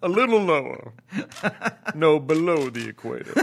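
laughing through a seductive request; Al Gore voice. Retold or imitated. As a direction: Al Gore at the massage table, giggling. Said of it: "Al Gore's ... massage therapist".

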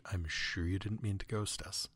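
ordinary delivery

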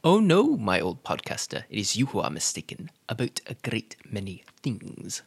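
low, soft Scottish-tinged Emperor; menacing Emperor with a Scottish lilt; Emperor accent turned Scottish. Acted. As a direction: slip into a Scottish villain voice, low and quiet. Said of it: "You just turned Scottish".

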